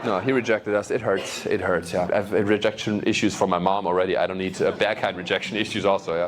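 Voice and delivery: usual speaking voice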